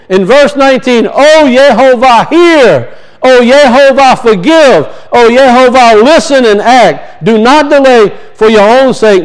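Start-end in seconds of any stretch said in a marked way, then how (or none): 1.1-9.2 fervent prayer. Acted. Preacher reading Daniel's plea to Jehovah, fervent prayer. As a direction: plead each petition with force, pausing after each.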